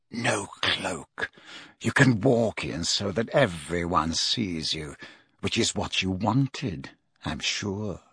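low, soft, measured menace; Snape, coldly taunting. Acted. Snape denies Harry the cloak, coldly taunting.

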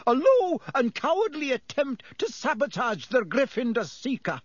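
high-pitched, indignant scolding; furious shouting. A narrator's reading. McGonagall punishing the Slytherins, shouting in fury. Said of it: "she was shouting".

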